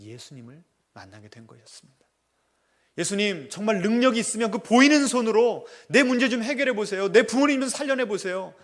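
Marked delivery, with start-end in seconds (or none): 3.0-8.5 loud, high challenge; a grieving son challenging Jesus, loud and defiant; defiant demand. Retold or imitated. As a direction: dare Jesus to prove himself. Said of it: "라고 했던 자기의 그 입술의 고백이".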